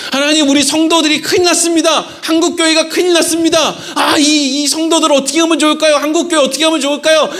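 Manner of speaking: high, anguished crying out to God; mock pleading prayer. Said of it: "이렇게 막 부르짖었더니".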